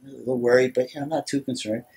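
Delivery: normal talking voice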